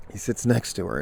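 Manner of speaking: normal talking voice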